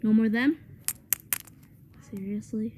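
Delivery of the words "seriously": whispered. Real, not acted. Whispered disbelief.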